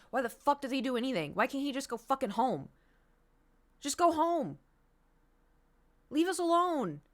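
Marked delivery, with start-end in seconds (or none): 3.8-7.0 higher, rising angry plea; exasperated demand. Real, not acted. Streamer telling absent tech boss to leave, exasperated. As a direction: send them away, fed up and insistent.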